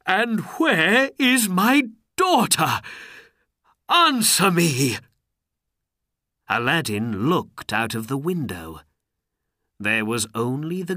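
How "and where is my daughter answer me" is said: loud, high-pitched furious shouting; Sultan's angry outburst; angry demand. A narrator's reading. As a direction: demand an answer in a rage. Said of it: "he cried angrily".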